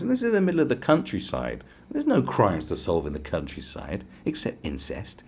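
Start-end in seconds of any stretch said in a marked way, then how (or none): none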